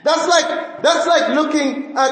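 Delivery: normal talking voice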